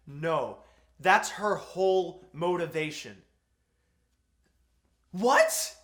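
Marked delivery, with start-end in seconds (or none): 5.1-5.8 loud, high, disbelieving what; incredulous what. Real, not acted. Ranting to viewers about the plot, incredulous yelp. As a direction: throw up your hands in disbelief.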